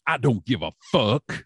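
imitating a gruff brush-off to a newcomer; put-on voice, mock dismissive; Michael Hayes. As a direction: do his blunt put-down in character. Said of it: "And Michael turns and looks at me".

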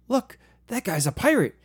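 put-on high, loud kid voice then a pirate yarg; child pointing out a pirate with glee; excited kid. Son at the checkout shouting about the cashier, high and loud. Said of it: "he's like".

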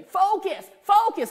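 high, loud focus, focus; husband pushing her to focus; urgent command. Retold or imitated. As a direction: bark it twice like a coach.

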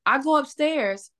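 ordinary talk

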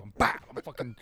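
ordinary talk, nothing marked

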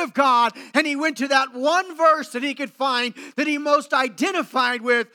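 fervent preaching. Acted. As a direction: preach it out with full conviction. Preaching at full force.

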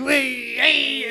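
loud high Guido "hey"; Italian Guido. Retold or imitated. Guido son protests to his family, loud Italian Guido voice. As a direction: call out like a Jersey Guido. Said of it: "talking like an Italian Guido".

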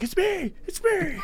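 squeaky, desperate It's me; high pleading yell. Retold or imitated.

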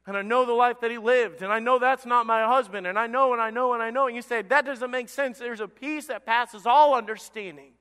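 loud, high, insistent testimony; emphatic pulpit voice, pressing each phrase home; fervent preaching. Acted. Preaches the widow's certainty at full force.

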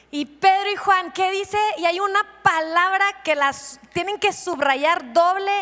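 ordinary talk, nothing marked

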